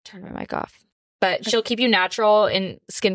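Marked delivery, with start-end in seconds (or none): 0.1-0.8 whispered aside. Real, not acted. Aside about her microphone.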